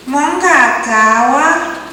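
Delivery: high, slow questioning lilt; Creek woman asking. Retold or imitated. Second Creek woman doubting they finish, a high slow question. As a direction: lift it up high and stretch it. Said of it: "Creek woman number two says".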